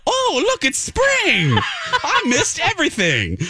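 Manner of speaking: high, airy oblivious chirp with a laugh; naive cheerful surprise. Retold or imitated. Coworker emerging late after the storm, high clueless chirp. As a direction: play it wide-eyed and clueless. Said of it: "Lex came out of her hole like".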